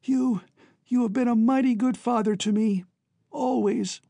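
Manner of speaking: high, halting, heartfelt son; choked with emotion. Acted. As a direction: thank your father through a lump in the throat. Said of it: "his voice choked".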